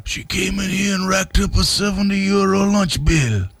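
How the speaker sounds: put-on high Italian-accented gripe; Giovanni Capelli. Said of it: "Griped Giovanni Capelli".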